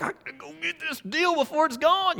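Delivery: high, mock-excited bargain hunter; eager rush. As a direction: blurt it like you've spotted a steal. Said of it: "that adrenaline rush you get".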